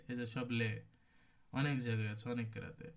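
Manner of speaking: ordinary delivery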